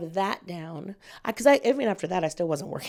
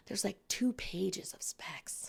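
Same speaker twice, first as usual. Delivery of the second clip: whisper; 15 dB quieter, slower than usual. Real, not acted. Whispered aside about long specs.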